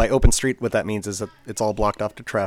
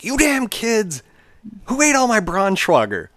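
high, loud, slow mock grumble; angry dad; 12 semitones higher, 6 dB louder, slower than usual. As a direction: scold the kids like a cranky father. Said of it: "getting really angry".

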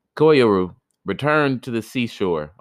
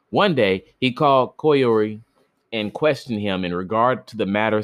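ordinary delivery

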